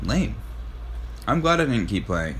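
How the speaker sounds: normal talking voice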